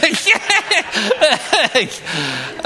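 a long, high burst of real laughter; can't stop laughing at himself. Real, not acted. Preacher recalling falling on the beach, laughing high. Laughing at his own fall.

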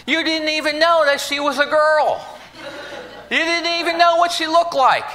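high, laughing disbelief. Real, not acted. Preacher laughing about the cake-covered baby, high and giggly. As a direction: laugh through the punchline.

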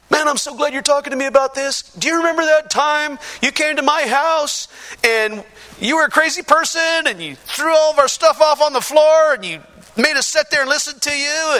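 high, worked-up gushing; excited friend. Retold or imitated. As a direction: act out an eager friend recalling a wild memory. Friend's glad speech acted out.

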